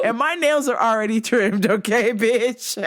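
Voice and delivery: mock threat through laughter; laughing taunt. Owner taunting cat about nails, laughing.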